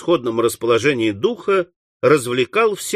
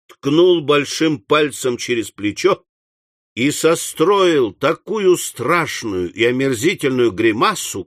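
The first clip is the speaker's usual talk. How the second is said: plain reading voice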